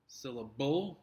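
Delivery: "Syllable" said syl-la-BLE, rising as a question; stress on last syllable. Shown as a demonstration. Teacher shows a silly wrong option, "Syllable" stressed syl-la-BLE. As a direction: throw the weight onto the end of "Syllable". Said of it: "It sounds silly".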